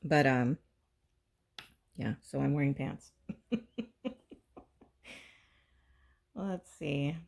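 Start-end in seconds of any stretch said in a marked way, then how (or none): 3.3-4.3 high quiet laugh; soft laugh. Real, not acted. Vlogger ending the pants story, soft high laugh. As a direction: chuckle to close the story.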